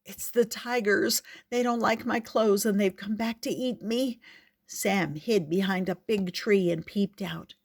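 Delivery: ordinary delivery, nothing marked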